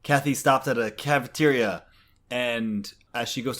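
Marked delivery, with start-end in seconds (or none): none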